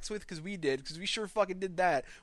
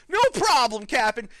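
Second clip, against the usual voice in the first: loud, higher, gung-ho yes to the captain; eager shout; 5 semitones higher, 10 dB louder than usual. Acted. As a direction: bark it out like a keen recruit. Eager reply to cart guy.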